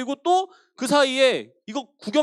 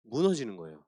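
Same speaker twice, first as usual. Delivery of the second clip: ordinary talk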